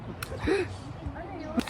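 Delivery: gasp. Real, not acted. Tasting a new snack.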